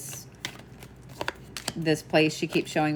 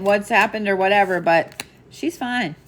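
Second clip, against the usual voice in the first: ordinary delivery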